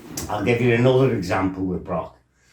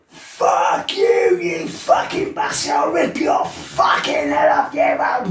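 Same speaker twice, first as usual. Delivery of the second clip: much higher, shouted threats; furious scream. Retold or imitated. Lad turning on the manager, screaming threats. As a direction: snap and roar in blind rage. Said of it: "The fucking head goes".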